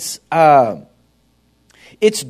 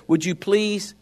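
like God gently asking for silence, slowly; God speaking gently; slower than usual. Retold or imitated. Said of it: "God would want you just to say".

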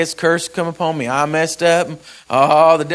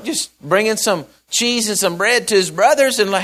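ordinary delivery

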